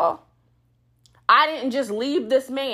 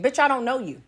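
ordinary delivery

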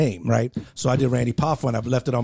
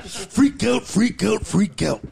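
Macho Man catchphrase; 8 semitones higher, much slower than usual. Retold or imitated. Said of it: "Macho promos".

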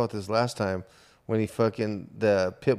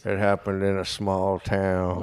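cowboy voice. Retold or imitated. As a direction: tell it like an old-time cowboy. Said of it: "just doing cowboy voice".

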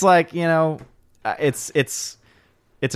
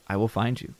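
softer, low-key movie threat; quietly menacing in jest; mock threat; 3 semitones lower, 8 dB quieter than usual. Reading a viewer's threat over Excalibur, quiet mock menace. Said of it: "is threatening me".

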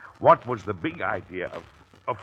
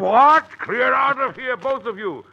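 high, loud blustering order; father shooing his sons out; mock-angry shout; 14 semitones higher, 7 dB louder, slower than usual. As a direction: roar them out of the room.

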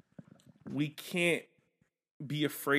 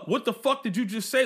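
mock confrontation; 7 semitones higher than usual. Imagined confrontation.

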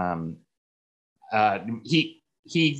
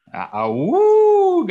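long, high, loud drawn-out howl; 10 dB louder, much slower than usual. Acted. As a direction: throw your head back and howl it slow. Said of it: "that's what I will be saying".

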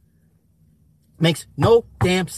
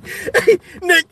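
high, louder laughing talk; 10 semitones higher, 4 dB louder than usual. Real, not acted. Laughing while telling his friend about the story. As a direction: still cracking up.

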